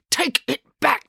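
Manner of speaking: high, insistent demand. A narrator's reading. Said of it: "demanded April".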